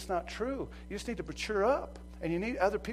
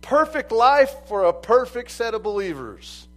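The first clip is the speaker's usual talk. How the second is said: loud, high-pitched pulpit shout; fiery preaching; 7 semitones higher, 12 dB louder than usual. Acted. Preacher crying out to his congregation, loud. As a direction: preach it out to the whole room.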